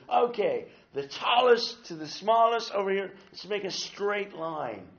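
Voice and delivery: line them up like a sailor giving orders; sailor mode. Retold or imitated. Leader lining up kids on the playground, mock sailor mode. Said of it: "goes into his sailor mode".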